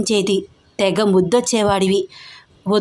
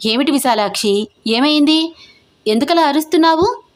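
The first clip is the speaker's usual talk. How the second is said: alarmed asking; 5 semitones higher than usual. A narrator's reading. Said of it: "అడిగింది అమల".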